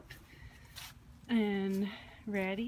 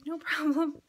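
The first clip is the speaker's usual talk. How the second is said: receptionist; 7 semitones higher, 6 dB louder than usual. Retold or imitated. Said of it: "She's like".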